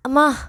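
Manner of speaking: sudden high pained yelp; cry of pain. A narrator's reading. Girl hit on the head cries out in pain. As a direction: cry out as you are struck. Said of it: "అని అరుస్తూ".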